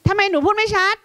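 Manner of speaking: high-pitched mock questioning of a child; questioning teacher. Teacher asks child why speech is unclear, high and questioning. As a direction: put on a high, fussing teacher voice.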